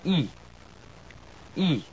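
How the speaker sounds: "E" as French close i, lips spread, tongue high front; French vowel E. Shown as a demonstration. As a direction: say "E" like the French i sound, crisp and pure. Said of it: "the first one is".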